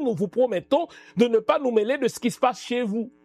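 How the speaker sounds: ordinary talk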